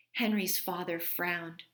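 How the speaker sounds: ordinary delivery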